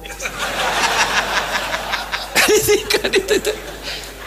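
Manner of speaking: laughing hard after the joke; long breathy laughter. Real, not acted. Preacher laughing at his own Jibril joke, soft and long.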